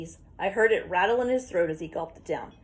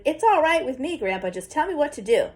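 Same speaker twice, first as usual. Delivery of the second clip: higher, louder eager blurt; 7 semitones higher, 4 dB louder than usual. A narrator's reading. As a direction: burst out ready to go. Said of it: "I reared back and blurted".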